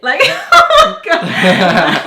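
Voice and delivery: loud, drawn-out laughing reaction; laughing outburst. Retold or imitated. Patient reacting to "You did it", laughing loudly. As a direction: burst out high and loud through laughter. Laughing at the hygienist's joke retold.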